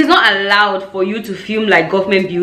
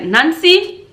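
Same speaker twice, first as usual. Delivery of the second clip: raised-pitch big-sister command; retold firm order; 6 semitones higher, slower than usual. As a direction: order her off, pausing between phrases.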